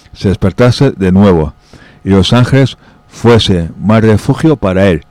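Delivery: plain reading voice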